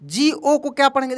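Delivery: normal talking voice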